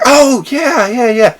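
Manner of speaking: mock high recognition; high realising yeah. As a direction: boss suddenly remembering. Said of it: "It's like".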